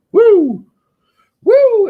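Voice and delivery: high loud Ric Flair woo; wrestler woo. Pretend. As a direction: whoop it like a pro wrestler. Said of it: "That's like a wrestler thing".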